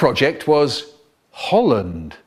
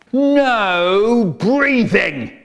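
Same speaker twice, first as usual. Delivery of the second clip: stern inspector; 7 semitones higher, 4 dB louder, much slower than usual. Acted. Inspector gives a stern order.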